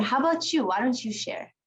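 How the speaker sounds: ordinary talk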